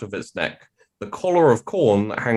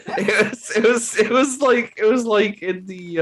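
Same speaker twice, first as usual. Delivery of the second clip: laughing; 13 semitones higher than usual. Real, not acted. Laughing while mimicking friend.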